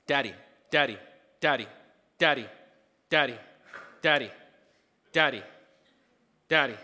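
loud insistent calls of daddy, one by one; a lightly voiced child calling. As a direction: call like a kid who wants attention. Child calling parent repeatedly.

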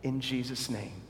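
soft reverent closing amen; closing prayer. Acted. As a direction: bring it down into a benediction. Closing prayer over music.

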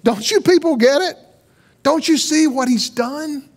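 the veil pleading with the people; lightly voiced veil, insistent; mock shout. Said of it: "It was the veil shouting".

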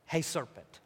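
low, quiet try at an Italian mobster; half-hearted Italian-accent Godfather attempt. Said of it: "in an Italian accent or something".

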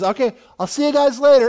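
lightly acted high, loud goodbye; mock breezy farewell. Elisha leaving the duped army behind, mock breezy farewell.

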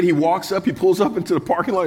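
ordinary talk, nothing marked

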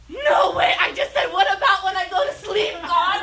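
high, loud shout of disbelief; amazed shout. Retold or imitated. Leader amazed again that God answered her, shouting. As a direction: shout it out, stunned and thrilled. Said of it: "she goes, again".

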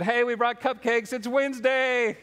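high, drawn-out singsong hello; hammed-up eager visitor; chirpy doorstep greeting. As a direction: announce it to the neighbors in a high, slow chirp. Acted repeat doorstep visit with cupcakes.

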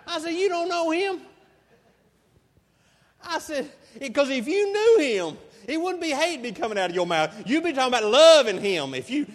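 fervent insistence. Retold or imitated. Preacher insisting to the man that he doesn't know God, fervent and loud. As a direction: win him over with rising fervor.

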